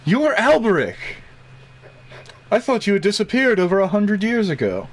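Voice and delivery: a hint of an astonished old wizard; old man voice. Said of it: "he says in an old man voice".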